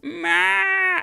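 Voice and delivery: high mock meow; cat meow. Cat answering his owner with a meow. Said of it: "He's like".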